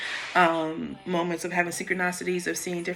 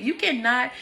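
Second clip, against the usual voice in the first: ordinary talk, nothing marked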